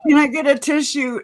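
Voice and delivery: high, mock whimpering request; mock tissue plea. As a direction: ham up the pitiful plea. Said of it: "I was like".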